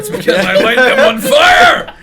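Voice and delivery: high loud laughing shout. Real, not acted. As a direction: yell it triumphantly. Shouted joke amid laughter.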